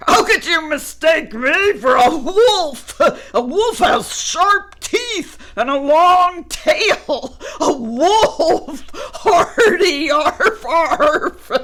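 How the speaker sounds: high, loud laughing scoff; amused dog. Acted. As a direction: bark with laughter at the idea. Dog laughs at wolf mistake.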